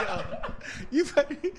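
high, wheezing laughter breaking up the words; helpless laughter. Real, not acted. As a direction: barely get it out through real laughing. Cracking up at the bubble-gum line.

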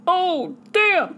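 high, amazed "damn"; impressed surprise. Real, not acted. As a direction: react like you can't believe it. Reaction to the letter.

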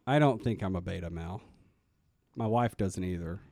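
low-pitched, unconvincing denial; unconfident. Real, not acted. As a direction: deny it without much conviction. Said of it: "You don't sound very confident on that".

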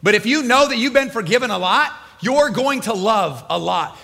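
ordinary talk

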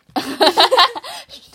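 loud high giggle; giddy high laugh at the teasing; high burst of laughter. Real, not acted. Teased about screaming.

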